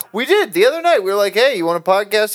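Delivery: high excited retelling. Retold or imitated. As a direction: chirp it eagerly.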